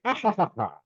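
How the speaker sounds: high, falling mock-villain laugh; gloating gnome cackle. Gnome running off after beating the brother, gloating laugh. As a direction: cackle as you scamper off.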